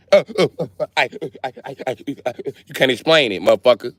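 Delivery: a teacher caught out, stumbling; lightly voiced flustered teacher. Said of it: "I had to ask my teacher".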